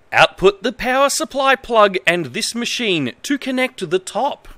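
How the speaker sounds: mildly stilted reading of a badly translated manual; manual read-aloud. Streamer reading a bad manual to viewers, lightly formal. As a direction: read it out straight, a touch formal.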